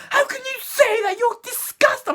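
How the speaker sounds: hammed-up indignant teacher; mock outraged teacher. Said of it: "trying to give me verbal".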